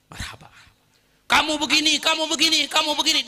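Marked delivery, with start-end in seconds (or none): 1.3-3.3 high, loud repeated jabs; berating insults. Retold or imitated. Insulter berating Ali to his face, scornful. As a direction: pile the insults on him.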